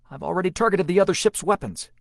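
fast, loud and high report; crisp gunner's report; sharp, clear voice. A narrator's reading. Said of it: "a sharp, clear voice".

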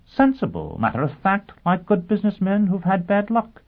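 quick to come in, climbing high; prompt answer. A narrator's reading. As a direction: jump in promptly, pitch rising as it goes. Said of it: "Sheffield answered promptly".

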